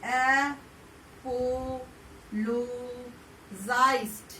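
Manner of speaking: Apologised stretched out slowly, each syllable clear; slow classroom demo of apologised; slow model word. Shown as a demonstration. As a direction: spell out apologised with your voice, slowly. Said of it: "साथ में बोलेंगे हम लोग".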